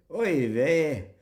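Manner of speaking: mock Glaswegian Yiddish exclamation. Shown as a demonstration. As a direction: say "Oy vey" with a broad Glaswegian accent. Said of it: "Yiddish spoken in the Glaswegian accent".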